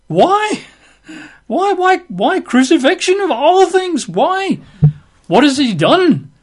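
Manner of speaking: a governor who can't believe the demand; incredulous pleading. Retold or imitated. Pilate pleading with the crowd over Jesus, incredulous. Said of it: "Pilate says".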